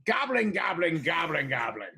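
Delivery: lightly voiced goblin chatter; goblin speech. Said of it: "in goblin, he says".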